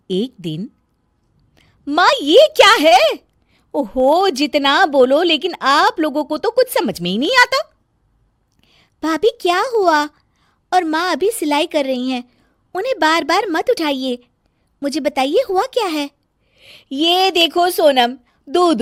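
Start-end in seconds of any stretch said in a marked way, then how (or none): 1.9-7.8 shrill complaining daughter-in-law, high and loud; nagging bahu. Acted. Daughter-in-law scolding her mother-in-law, high loud complaint. 9.0-16.1 lightly voiced young girl, higher; sister-in-law Sonam. Sonam asking her sister-in-law what happened, lightly raised voice.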